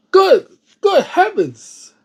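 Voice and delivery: slow, high, drawn-out dismay; appalled. Real, not acted. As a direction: stretch it out in shock. Reactor appalled at story.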